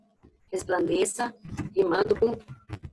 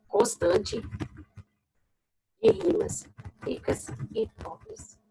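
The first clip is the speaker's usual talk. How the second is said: slow dictation; 6 semitones higher, 4 dB quieter, much slower than usual. Shown as a demonstration. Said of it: "dictating to class".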